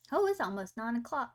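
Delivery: ordinary talk, nothing marked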